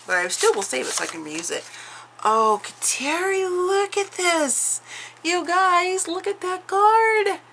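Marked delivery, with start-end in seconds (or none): 2.2-7.4 high, slow, stretched-out gushing; gushing delight. Real, not acted. Crafter to viewers over a friend's handmade card, high delighted gushing. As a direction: swoon over the card with a soaring, drawn-out voice.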